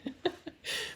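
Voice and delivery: breathy laugh. Real, not acted. Laugh after joke.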